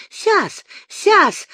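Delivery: high-pitched eager babble; frightened accented babble; babbling. A narrator's reading. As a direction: squeak it out, desperate to comply. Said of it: "залепетал князёк".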